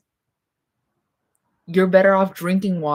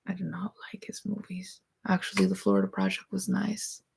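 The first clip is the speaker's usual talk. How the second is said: muttered aside; 8 dB quieter than usual. Real, not acted. Aside about Sean Baker.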